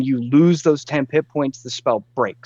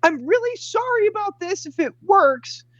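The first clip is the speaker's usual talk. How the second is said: very high-pitched strained shout; mock shout. Quinn calling to the Triceratops before casting, high mock shout. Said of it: "he shouts".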